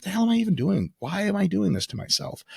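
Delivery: high, incredulous self-reproach; mock despair at a plate of food; self-exasperated. Speaker to himself at a meal, high mock despair. Speaker's own past lament.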